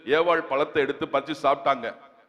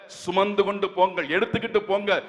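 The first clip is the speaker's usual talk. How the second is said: normal talking voice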